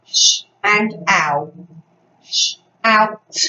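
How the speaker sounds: "Sh" and "ow" as slow separate sounds; sounding out. Shown as a demonstration. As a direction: break "Shout" into "Sh-, out" with clear gaps. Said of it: "Fred Talk".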